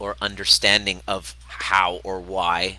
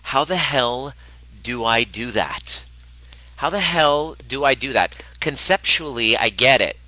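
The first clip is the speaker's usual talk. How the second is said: raised-voice, exasperated retort; 4 semitones higher, 5 dB louder than usual. Retold or imitated. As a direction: act out baffled frustration at a friend's advice. Acted exasperated reply to friend.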